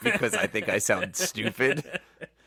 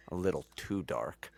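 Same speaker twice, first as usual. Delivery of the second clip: deadpan alien line; 7 semitones lower, 12 dB quieter than usual. Retold or imitated. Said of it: "He goes".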